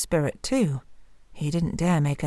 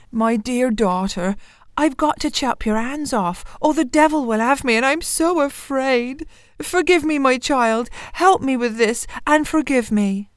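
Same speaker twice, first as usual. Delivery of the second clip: higher, pleading, frightened miller; frightened father; 7 semitones higher than usual. Acted. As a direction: plead with your daughter for forgiveness. Miller begs daughter's forgiveness.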